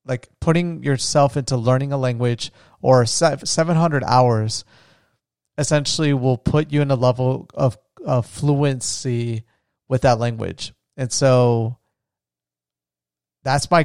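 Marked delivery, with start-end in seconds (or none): none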